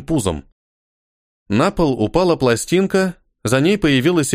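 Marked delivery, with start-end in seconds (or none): none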